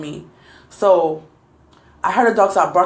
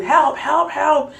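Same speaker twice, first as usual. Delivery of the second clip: higher-pitched mock cry for help; retold cry for help; 7 semitones higher, much slower than usual. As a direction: call for help without really yelling. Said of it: "she heard me screaming for help".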